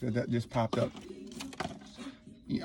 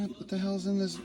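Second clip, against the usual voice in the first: ordinary delivery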